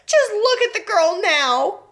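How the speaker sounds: high, slow distressed cry; cried out. Acted. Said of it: "cried Missus Beauregard".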